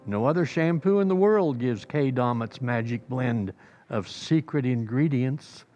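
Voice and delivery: ordinary delivery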